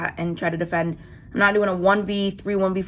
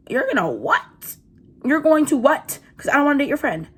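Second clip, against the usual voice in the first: louder, higher outraged disbelief; 6 semitones higher, 4 dB louder than usual. Real, not acted. Teen recounts threats from girls, outraged disbelief. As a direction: demand it in shock.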